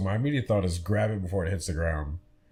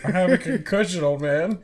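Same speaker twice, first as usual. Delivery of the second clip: mock whine; 12 semitones higher, 6 dB louder than usual. Friend mocks whiny son, laughing.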